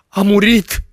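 loud, very high-pitched scream. A narrator's reading. Girl bent over her unconscious fiancé, screaming. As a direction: shriek it out in horror. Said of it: "țipă ea".

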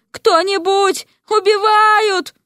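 very high, drawn-out scream for help; slow, high wail of alarm; panicked shout. Acted. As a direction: cry murder at the top of your pitch. Trapped man screams for help.

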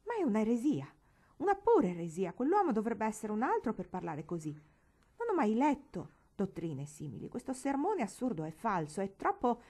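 loud, indignant tirade; outraged. A narrator's reading. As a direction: denounce it, outraged. Outraged aunt condemning sermon.

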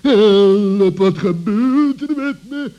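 loud, high yelp of alarm; panicked cry for help. Acted. Trapped fox to himself, crying out in loud, high panic. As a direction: yelp it out as the trap drops. Fox caught by falling rope.

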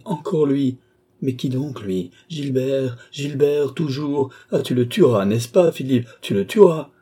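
high-pitched, mounting insistence; agitated plea. A narrator's reading. André begs a brother to kill Gilbert, agitated and mounting. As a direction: build to a loud, high demand.

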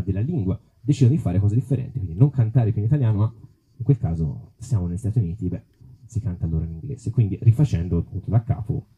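ordinary talk

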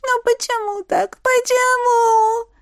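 high, drawn-out wail; moaning lament. A narrator's reading. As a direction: moan it out, stretching the last word. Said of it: "стенала она".

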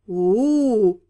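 low, long drawn "oo-oo"; drawn-out "ooh". A narrator's reading. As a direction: stretch out a low knowing "ooh". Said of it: "протянул красноволосый". (низкое протяжное «у-у-у»)

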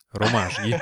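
ordinary talk

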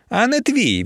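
high, cutting retort; menacing retort. A narrator's reading. Emiliia warning her rival off, menacing retort. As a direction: cut her off as threateningly as you can. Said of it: "відрізала Емілія якомога грізніше".

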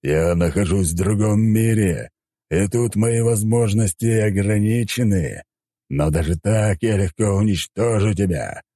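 slow, grand and menacing; drawn-out, superior threat; haughty deity. Acted. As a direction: pronounce it like a mighty god, stretching the words.

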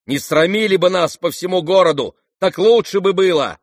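high, loud reproach; angry rebuke. Acted. Ganya goes on shouting rebuke.

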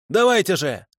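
высокий отчаянный выкрик; требует выстрела, срываясь; крик. Acted. Фанатик кричит охотникам. (пленник кричит, высоко и отчаянно)

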